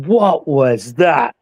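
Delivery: slow startled question, odd voice. Retold or imitated. Luke startled in the dark hall, asking slowly. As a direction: jump and ask it slowly, spooked. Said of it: "Luke suddenly jumped, went".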